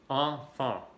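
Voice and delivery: "Enfant" said the French way with nasal vowels, ɑfɑ̃; teacher modelling "Enfant" in French; French pronunciation. Shown as a demonstration. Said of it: "la E y la N".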